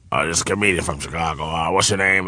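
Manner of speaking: low, soft, fast mumble; low absent-minded old-timer, acted; imitating older comedian. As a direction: mumble a casual introduction. Acts Foxx introducing the comic.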